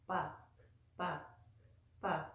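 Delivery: pa with lips closed and no puff of air; unaspirated p. Shown as a demonstration. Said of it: "p sound like this".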